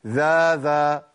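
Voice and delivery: ذا said with the lips held still; wrong demo of ذا; fixed lips demo. Shown as a demonstration. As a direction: say ذا without moving the lips. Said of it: "ولا أقول".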